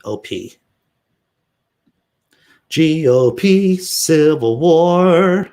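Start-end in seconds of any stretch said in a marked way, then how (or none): 2.7-5.5 announcer. Acted. Announcing a headline.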